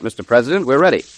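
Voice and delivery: ordinary talk, nothing marked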